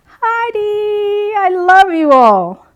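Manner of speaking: high, loud affectionate call through the doorway. Retold or imitated. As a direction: sing it out to the class through the door. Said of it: "stick my head in the door and say".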